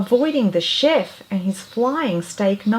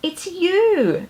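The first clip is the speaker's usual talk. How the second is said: much higher, thrilled recognition; excited cry; 11 semitones higher than usual. Acted. Mouse recognises the singing bird, thrilled cry. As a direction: burst out with delight, high. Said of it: "Clarice cried".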